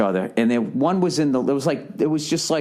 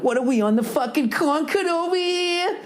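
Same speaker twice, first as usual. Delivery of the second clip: high, squeaky mock disbelief; mock incredulous; 12 semitones higher than usual. To himself on a fast flight, high mock disbelief. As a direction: squeal it like you can't believe the speed. Mock outrage quote on plane.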